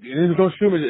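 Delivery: high, frightened begging; mock panicked plea. Hiding behind Todd, begging not to be shot, high and scared. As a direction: plead high like you're cornered. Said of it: "He's like".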